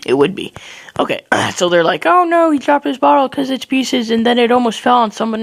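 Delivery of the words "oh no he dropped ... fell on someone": high-pitched fretting onlooker; mock alarm. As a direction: imitate bystanders in a fluster. Voices alarmed onlookers in story.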